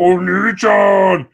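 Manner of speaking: hammed-up anime squeal of big brother; mock anime cry. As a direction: stretch it out like a cutesy anime sister. Mimicking anime sister's cry.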